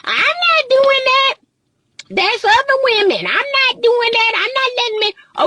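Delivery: high, sing-song mock of a defensive woman; mocking women's voice. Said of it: "this is what some women say".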